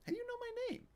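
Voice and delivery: put-on wide-eyed kid describing genies; young boy voice. Stanley voiced as child.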